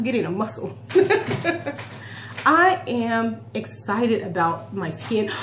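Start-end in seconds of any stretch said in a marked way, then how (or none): 0.8-2.4 giggle. Real, not acted. Laughs at her own muscle.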